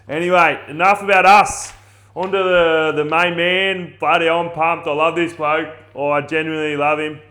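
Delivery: loud, high, hyped-up welcome; pumped intro. Real, not acted. Host hyping up his guest before the intro, loud and pumped. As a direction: announce the guest like a hype man.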